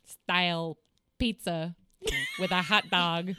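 hammed-up Chicago accent; Chicago accent attempt. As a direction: put on a broad, comic Chicago accent. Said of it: "they live in Chicago".

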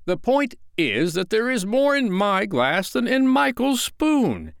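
high, loud, building protest; bursting with indignation. A narrator's reading. Father protesting his bigger dose, indignant. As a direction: argue as if your proud heart will burst. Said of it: "His proud heart was nearly bursting".

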